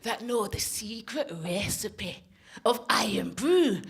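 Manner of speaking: put-on slow, drawn-out Scottish accent; George. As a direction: reveal a great secret in a hammed-up Scottish accent. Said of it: "this is how George sounded".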